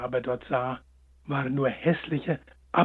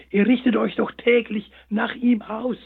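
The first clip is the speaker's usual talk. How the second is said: hare explaining; 6 semitones higher, 5 dB louder than usual. A narrator's reading. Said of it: "entgegnete der kleine Schlitzohr".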